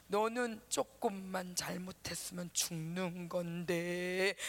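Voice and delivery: low, slow ominous voice; slow and low, like a menacing whisper in the head; haunting inner voice. Retold or imitated. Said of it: "이런 말이 자꾸 들리는 거야". (낮고 느린 불길한 목소리)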